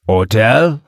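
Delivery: put-on French-accented one-word question. A narrator's reading. French caller checking the meeting place, French accent. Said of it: "A deep male voice in a French dialect".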